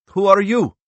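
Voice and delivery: high, loud demand from a startled man. A narrator's reading. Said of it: "he demanded".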